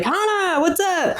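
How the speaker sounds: high, bright mock hello to a friend; cheery greeting. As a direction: sing out the name like an old pal. Friendly greeting in bars recalled.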